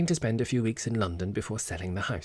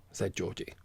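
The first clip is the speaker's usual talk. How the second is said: ordinary delivery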